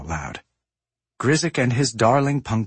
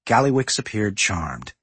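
ordinary delivery, nothing marked

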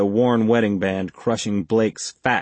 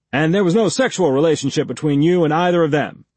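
higher, louder pushy cop voice; gruff interrogator; 7 semitones higher, 5 dB louder, faster than usual. A narrator's reading. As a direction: press him like a hostile detective. Blake presses on sex question.

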